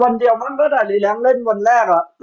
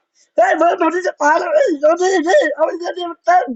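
high, frantic mock yelling at a teammate; imitating noisy teammates; 8 semitones higher than usual. Streamer imitates his rowdy party yelling mid-game, high mock panic. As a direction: ham up the party's panicked chaos. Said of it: "โวยวายกันทั้งตี้อะ".

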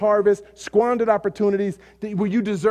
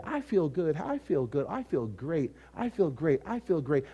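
mock self-satisfied listener; 3 semitones lower, 8 dB quieter than usual. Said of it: "how'd that message make you feel today".

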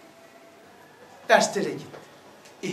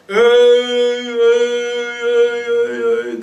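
1 imitated echo; much slower than usual. Shown as a demonstration. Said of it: "Dalga dalga".

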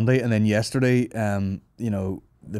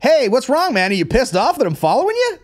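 high, loud taunting paparazzo voice; mocking paparazzo; 14 semitones higher, 7 dB louder than usual. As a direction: jeer it up high and loud like a pushy photographer. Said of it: "chasing people down like".

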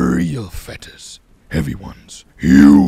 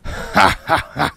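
big wolf laugh; booming laugh; 7 semitones higher, 15 dB louder, slower than usual. Acted. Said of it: "he laughed then".

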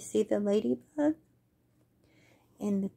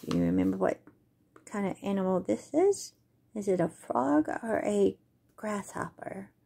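usual speaking voice

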